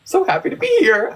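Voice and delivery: high-pitched chirpy imitation; mock chipper. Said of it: "she came out so chipper".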